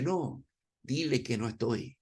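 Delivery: bajito, esquivando la llamada; papá escondiéndose, murmurando la excusa; excusa en voz baja. Acted. Papá susurra que no está. (excusa murmurada en voz baja)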